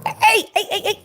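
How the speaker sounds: hey said high and quick; quick high calls to stop the pup; sharp calls. Real, not acted. Calling out at biting puppy.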